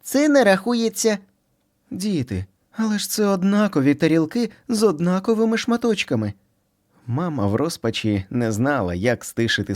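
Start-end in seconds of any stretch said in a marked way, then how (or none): 0.0-1.2 high, sulky retort; pouty bunny kid. Acted. Squeak to his brother, high and sulky. 1.9-6.3 mother bunny. Acted. Mother calming the brothers.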